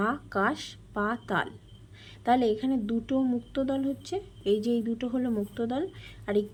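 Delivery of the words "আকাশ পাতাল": আকাশ পাতাল said slowly, syllable by syllable; syllable split. Shown as a demonstration. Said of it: "তো এখানে দেখো". (আকাশ পাতাল ধীরে দল ভেঙে উচ্চারণ)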